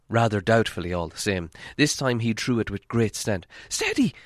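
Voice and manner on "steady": sharp, very high call. Acted. Hobbit warning the thrower, high sharp call. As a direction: cry out a warning. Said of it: "said Bilbo".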